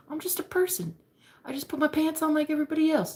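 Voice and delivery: high-pitched mock modesty; imitated humble crush. Crush telling his admirer he's ordinary, mock humble. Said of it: "he's so humble too".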